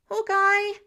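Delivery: high, loud call across the fight; excited yell to a teammate. Acted. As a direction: shout his name, thrilled not scared. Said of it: "he seemed excited".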